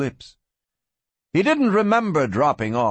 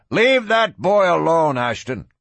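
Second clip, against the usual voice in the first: raised, higher-pitched challenge; sharp challenge; 5 semitones higher, 4 dB louder than usual. A narrator's reading. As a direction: cut in and challenge the sheriff loudly. Challenger interrupts the sheriff.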